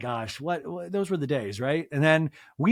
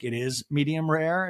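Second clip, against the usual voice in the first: ordinary talk, nothing marked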